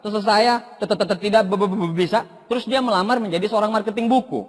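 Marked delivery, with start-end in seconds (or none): 0.2-2.2 mimicked stutter, stuck on openings. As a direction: imitate a man with a stammer. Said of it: "dia gagap".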